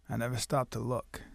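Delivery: low, quiet, ominous reply; chilling understatement. Retold or imitated. Father to drunk best friend, low chilling answer. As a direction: imitate a dangerous man answering softly.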